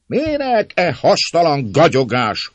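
slow, high, loud impatience; voice from crowd. A narrator's reading. Man in the mob tired of talk, calling out. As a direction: call out scornfully over the crowd. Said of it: "hangzott egy szózat a tömeg között".

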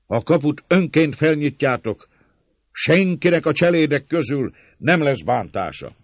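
very high, loud vow; proclaimed promise. A narrator's reading. Attacker promising servants no harm, proclaiming loudly. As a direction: pledge it at full voice. Attacker swears safe passage aloud.

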